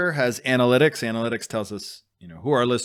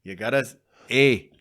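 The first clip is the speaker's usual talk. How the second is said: loud, hammed-up Canadian "take off, eh"; Rick Moranis Canadian accent; 4 semitones higher, 8 dB louder than usual. Doing a Canadian comedy bit for the cohost, loud and hammed-up. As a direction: ham up the hoser Canuck bit. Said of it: "Rick Moranis".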